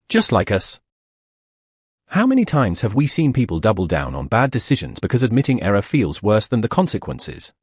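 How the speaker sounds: ordinary delivery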